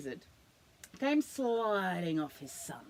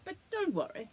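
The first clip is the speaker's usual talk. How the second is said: high, louder, airy emu; 10 semitones higher, 4 dB louder, faster than usual. Acted. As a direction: make the emu sound high and fussy.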